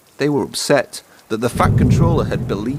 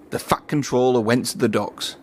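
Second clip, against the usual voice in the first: ordinary delivery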